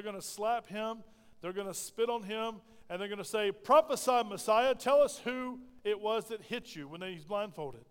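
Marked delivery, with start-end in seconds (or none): 3.5-6.9 loud, raised jeer at a captive; taunting call. Retold or imitated. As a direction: call it out as a mocking demand. Captors taunting the blindfolded Messiah.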